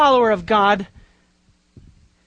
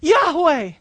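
proclaiming shout; 5 semitones higher, slower than usual. Acted. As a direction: proclaim it like a king's title. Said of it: "Abram turns around and he says".

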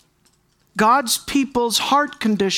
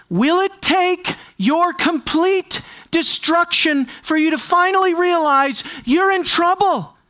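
voicing the prophet's rebuke; 7 semitones higher than usual. Preacher voicing Hosea's rebuke.

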